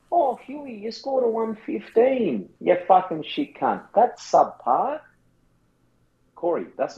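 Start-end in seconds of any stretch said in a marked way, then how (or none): 0.1-5.0 high, slowed mock tirade at the player; hammed-up rant at Huey, pitch way up; angry coach parody. Imitating a fantasy coach berating Huey, hammed-up. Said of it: "Your V C George Hewitt".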